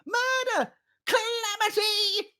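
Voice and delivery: the fleeing doppler raising a false alarm; panicked yell. Acted. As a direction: scream for help as you flee. Doppler keeps screaming alarm.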